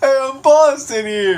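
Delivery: high, slow, exaggerated Boston greeting; Boston caricature; hammed-up Boston accent. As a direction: drawl out a silly fake Boston accent. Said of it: "So light on an accent".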